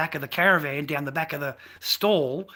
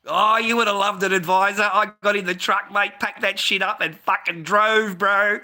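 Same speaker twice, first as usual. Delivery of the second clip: much louder and higher, crowing; gleeful boast; 8 semitones higher, 9 dB louder than usual. Real, not acted. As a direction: crow about it, loud and high. Gleeful boast about driving off.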